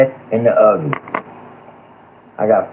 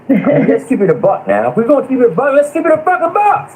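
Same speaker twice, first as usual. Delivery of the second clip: rising from a heated push to a much higher furious shout; building anger; faster than usual. Real, not acted. Panelist scolding feuding YouTubers, climbing into a high furious shout. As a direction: wind up until the voice cracks high with rage. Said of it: "I'm getting pissed right now".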